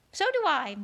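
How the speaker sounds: ordinary delivery, nothing marked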